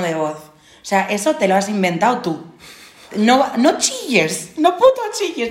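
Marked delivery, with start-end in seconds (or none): 3.2-5.5 exasperated rant. Pretend. Ranting at people who shout.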